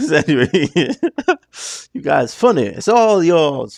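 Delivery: high, loud voice bubbling with laughter; real cracking up. Real, not acted. Reviewer tickled by the funny singers, laughing high and loud. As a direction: crack up helplessly while praising them.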